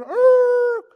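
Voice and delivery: shouted high dog bark; yelping bark from a dog chasing a car; dog bark imitation. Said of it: "seeing that dog chase you going".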